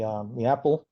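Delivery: ordinary talk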